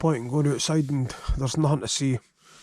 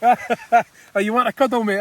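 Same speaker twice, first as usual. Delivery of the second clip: laughing; 6 semitones higher, 5 dB louder than usual. Real, not acted. Laughs at mate's cuddle offer.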